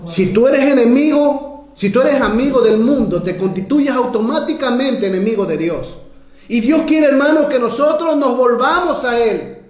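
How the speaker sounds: high, insistent pulpit exhortation; impassioned preacher pressing the point; fervent preaching. Real, not acted. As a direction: exhort the church with full conviction. Preaches at full force.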